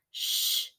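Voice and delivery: soft breathy shush. Acted. Jack shushing Annie in the house, breathy shush. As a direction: hush her sharply. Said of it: "said Jack".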